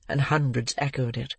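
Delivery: ordinary delivery, nothing marked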